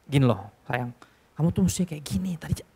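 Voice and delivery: put-on soft, loving explanation to a child; tender correction. A father softly explaining to his little child, tender. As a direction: coax the child gently.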